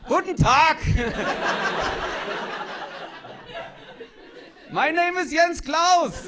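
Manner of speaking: loud, high German bark; shouted, hammed-up German introduction; unsexy German accent. Shown as a demonstration. German man introduces himself, loud harsh German accent. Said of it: "German, on the other hand".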